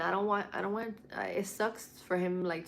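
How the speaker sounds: ordinary delivery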